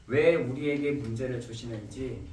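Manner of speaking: ordinary delivery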